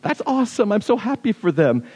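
high, delighted ooh; genuinely happy. Retold or imitated. Hearing good news of the one who hurt you, high delighted gush. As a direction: gush with real joy for them. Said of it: "you're like".

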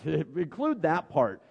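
drawn out through a laugh; laughing, slow. Retold or imitated. Pastor mocking Peter's autobiography, laughing slowly. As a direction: savour it while chuckling. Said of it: "would have said".

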